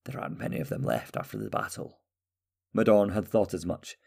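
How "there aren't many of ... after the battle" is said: put-on low, hushed old prisoner; old man whispering to the king about the Skurgs. A narrator's reading. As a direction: breathe it out like a frail old man. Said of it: "an old man said".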